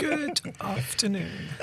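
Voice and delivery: slow, plummy posh greeting. Acted. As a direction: put on a posh accent. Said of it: "Why did you just put on a posh voice then?".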